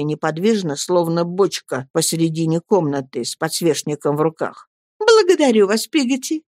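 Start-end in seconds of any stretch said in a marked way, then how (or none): 5.0-6.4 bright, higher cheerful thanks. A narrator's reading. Mother cheerfully thanks Peggotty, voice lifted high. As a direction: thank her gaily, pitched up. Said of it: "весело ответила матушка".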